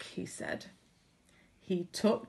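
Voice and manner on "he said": plain reading voice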